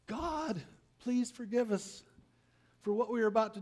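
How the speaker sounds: high and quick, begging; mock sailor prayer. Sailors pleading with God before throwing Jonah, high and fast. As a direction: rattle off a nervous prayer.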